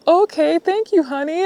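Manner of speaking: high, loud, sweet thank-you; imitating a grateful lady calling her honey; grateful stranger.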